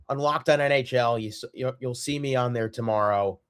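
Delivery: ordinary talk, nothing marked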